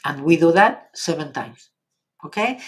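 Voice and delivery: ordinary talk, nothing marked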